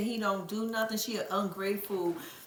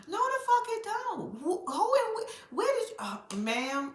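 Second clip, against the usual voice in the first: higher, incredulous rant; stammering shocked rant; indignant outburst; 10 semitones higher than usual. Real, not acted. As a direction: go up high in disbelief. Ranting at the mother.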